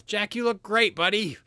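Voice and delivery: high, loud fake cheer; overly cheerful. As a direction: gush with forced cheer at Jack. NPC overcompensating to construct.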